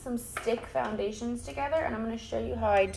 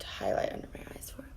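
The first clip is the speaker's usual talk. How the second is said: whispering; 8 dB quieter, faster than usual. Real, not acted. Aside to viewers.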